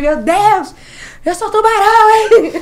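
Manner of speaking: high, loud, laughing exclamation; excited disbelief. Retold or imitated. Contando a reação no brinquedo. (exclamação alta e eufórica, rindo)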